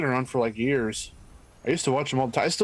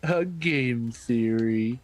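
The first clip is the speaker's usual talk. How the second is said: very slow, stretched-out catchphrase; host catchphrase impression; 2 semitones lower, much slower than usual. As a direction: drag out every word like a show host.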